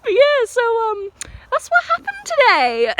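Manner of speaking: high, giggly sign-off; amused, still cracking up; laughing wrap-up. Real, not acted. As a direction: finish through leftover laughter. Wrapping up while cracking up.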